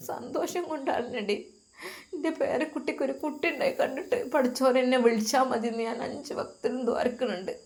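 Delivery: put-on soft, quiet old woman; an elderly grandma speaking gently from her bed; frail grandmother. Grandmother explains her tears.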